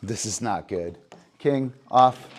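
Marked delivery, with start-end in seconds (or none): 1.4-2.3 loud, higher command to the dog; firm command. Real, not acted. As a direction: call the dog off sharply.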